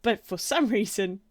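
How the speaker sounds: ordinary delivery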